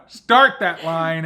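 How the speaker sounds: slow, loud, punched command; emphatic joking command. Acted. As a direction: drive each word home. Joking correction of the metaphor.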